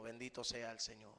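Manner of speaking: soft aside, Bendito sea el Señor dropped low and quiet; hushed devout aside; soft murmured blessing. Real, not acted. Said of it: "murmured blessing aside".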